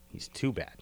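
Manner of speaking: whispered, voice dropped right down. Real, not acted. To his co-host, whispering that the villain is too bad. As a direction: breathe it out as a whisper.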